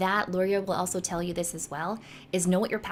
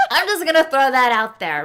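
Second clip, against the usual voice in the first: laughing; 5 semitones higher, 10 dB louder than usual. Real, not acted. Laughing aside.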